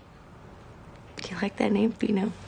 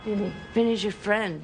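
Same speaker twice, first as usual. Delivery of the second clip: ordinary delivery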